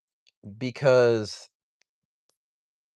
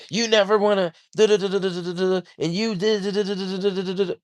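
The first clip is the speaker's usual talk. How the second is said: sing-song "duh duh" parroting, pitched up; mocking gibberish; 6 semitones higher, much faster than usual. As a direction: mimic her nagging as empty noise. Mocking partner's complaints, nonsense syllables.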